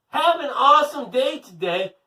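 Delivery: high, loud upbeat send-off; cheery sign-off. Real, not acted. Host wishing the children a great day, high cheerful call.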